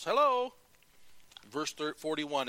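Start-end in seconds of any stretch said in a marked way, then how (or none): 0.0-0.5 high, loud called-out hello; hollered greeting. Acted. Mary calling at her cousin's door, hollering. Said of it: "she hollers out or yells".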